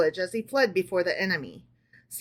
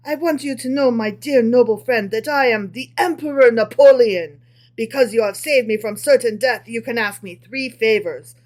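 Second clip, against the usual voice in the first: a light French flavor, grand and louder; hint of French accent; 5 dB louder than usual. Napoleon thanks the tailor, lightly French and grand. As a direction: play the grateful emperor with a touch of French. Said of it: "I cannot do a French accent".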